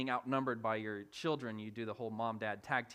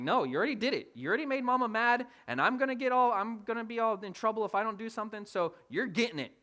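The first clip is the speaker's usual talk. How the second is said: raised, higher-pitched mock telling-off; loud comic parental rebuke; scolding dad; 10 semitones higher, 7 dB louder than usual. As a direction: lay down the law as a worked-up father. Acting out dad scolding daughter.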